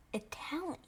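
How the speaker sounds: amazed, hushed whisper. Real, not acted. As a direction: breathe it out in disbelief. Stunned realization about the broccoli.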